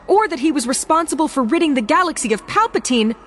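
high, loud furious outburst; sharp, shrill. Acted. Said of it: "her temper had gotten the best of her".

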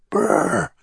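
shivery lips brr from the cold; shivering brr. A narrator's reading. Pulling blanket up, it's cold.

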